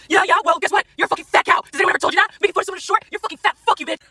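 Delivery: high-pitched mock rant; squeaky-high mocking imitation; mocking angry commenters. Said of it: "posting all kinds of shit like".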